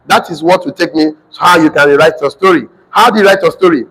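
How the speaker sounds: usual speaking voice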